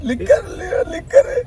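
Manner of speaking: high sobbing cry; crying wail. Acted. Ramesh sobbing out the word liquor to Pichanna. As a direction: break into a hammed-up sob.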